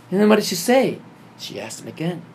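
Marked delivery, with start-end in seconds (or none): 0.1-1.0 loud, high, urgent question; acted-out incredulous questioning; astonished prompting. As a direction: press eagerly for the next bit. Retold eager questioning of brother.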